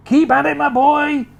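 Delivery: high, loud cheering on; excited cry. Acted. Mister Cranky dancing round the kitchen, crying out to George. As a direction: cry out with glee. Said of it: "cried Mister Cranky".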